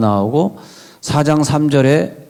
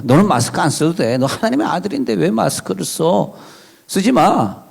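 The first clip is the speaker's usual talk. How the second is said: high-pitched mock devil egging someone on; devil's tempting voice; 6 semitones higher than usual. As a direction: wheedle it higher, as the tempter. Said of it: "이게 마귀 음성이라는 거예요".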